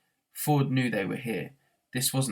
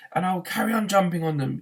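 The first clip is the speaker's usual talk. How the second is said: higher, louder angry yell; 6 semitones higher, 4 dB louder than usual. A narrator's reading. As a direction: yell it while running. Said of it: "yelled Arthur".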